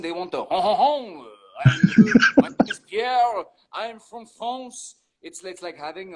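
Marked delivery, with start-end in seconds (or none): 0.5-1.2 hammed-up French nasal honking laugh; French accent. Shown as a demonstration. Showing what clients want from a French accent, hammed-up French. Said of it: "they want the French accent". 1.7-5.0 high, hammed-up French accent. Shown as a demonstration. Showing what clients want, exaggerated French accent. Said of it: "they want the French accent".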